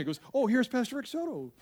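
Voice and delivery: usual speaking voice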